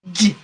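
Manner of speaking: "J" held alone, rounded lips, teeth touching; isolated J sound. Shown as a demonstration. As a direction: sound out "J" on its own. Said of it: "with the J sound, you round your lips".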